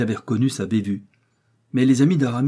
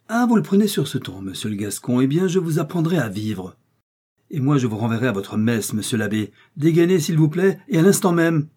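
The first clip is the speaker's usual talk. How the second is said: louder, higher angry challenge; 6 semitones higher, 5 dB louder than usual. Acted. Aramis angrily demands D'Artagnan draw his sword, louder. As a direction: throw down the gauntlet.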